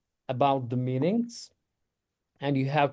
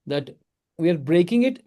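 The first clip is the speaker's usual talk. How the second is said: normal talking voice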